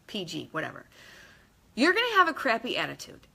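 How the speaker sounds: ordinary talk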